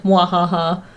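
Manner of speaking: low, sneering mwahaha; sneering panto villain laugh. Acted. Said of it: "I will pay twenty pounds to start an international incident".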